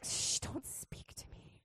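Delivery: slow, whispered shush; mock secretive hiss; hushing whisper. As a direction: shush them away in a whisper. Shushing God during worship.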